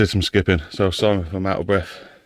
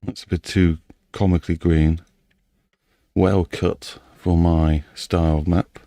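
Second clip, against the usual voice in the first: normal talking voice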